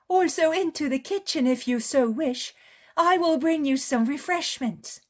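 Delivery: an eager hostess welcoming a guest; high, loud woman's voice; eager village woman. Acted. Woman offers hospitality.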